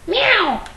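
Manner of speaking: very high mock meow. Meowing at the cat Bandit.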